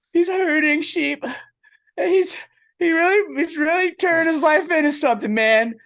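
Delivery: very high, strained tearful voice; hammed-up crying at a dog video; mock weepy. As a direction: squeak it out through fake tears. Retold weepy reaction to video.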